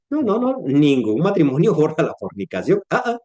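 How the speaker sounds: usual speaking voice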